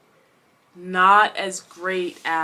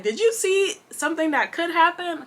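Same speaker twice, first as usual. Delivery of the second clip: high, eager retold question to her friend; excited question; 8 semitones higher than usual. Telling her friend about the book, high eager question. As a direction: ask it high and eager, bursting to share.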